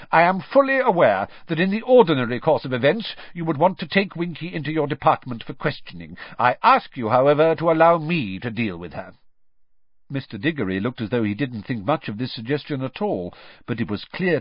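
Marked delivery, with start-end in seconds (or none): 0.1-9.1 clipped, brisk and louder; curt. Acted. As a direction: cut him off curtly. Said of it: "said Mister Crouch curtly".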